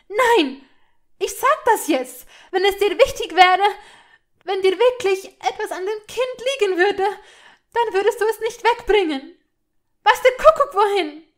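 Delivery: high, loud, unstoppable tirade; furious outburst. A narrator's reading. Hermine refusing Malfoy's interruption about the child, furious outburst. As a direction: shout over his attempt to interrupt.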